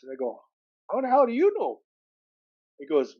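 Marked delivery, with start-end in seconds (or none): 0.9-1.8 high-pitched retold disbelief; incredulous question. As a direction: act out being caught off guard. Retold surprise at coworker knowing.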